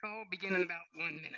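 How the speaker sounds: choppy, dropping audio; bad connection cutting out. Real, not acted. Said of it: "Your audio is cutting out a little bit there".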